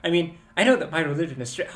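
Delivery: ordinary delivery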